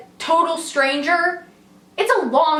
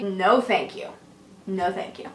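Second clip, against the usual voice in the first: lower, softer, gentle no thank you; nicely; 7 semitones lower, 5 dB quieter than usual. Retold or imitated. Politely refusing the stranger's kiss, gentle and soft. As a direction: let him down kindly and quietly. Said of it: "I nicely say".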